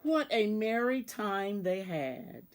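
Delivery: usual speaking voice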